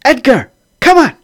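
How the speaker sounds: high, loud exasperated wife; scolding wife. Acted. Wife smacks husband and scolds him.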